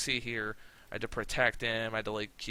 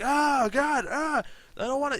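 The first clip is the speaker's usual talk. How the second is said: high, louder strained cry of pain; mock pained groan; 13 semitones higher, 5 dB louder than usual. Wrestler trapped in an armbar, high pained cry. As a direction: strain as if your arm's bent back. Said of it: "And Lashley's like".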